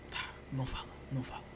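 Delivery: hushed whisper, cutting himself off; whisper, holding back a curse word; self-censoring whisper. Real, not acted. Podcaster stops himself swearing to his listeners, in a hushed whisper.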